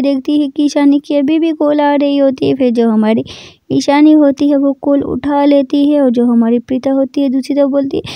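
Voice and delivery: ordinary delivery, nothing marked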